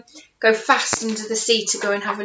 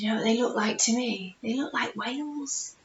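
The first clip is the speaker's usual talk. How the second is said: hushed; 9 dB quieter than usual. Acted. Hushed aside to listener.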